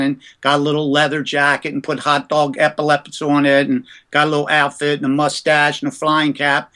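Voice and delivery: ordinary talk, nothing marked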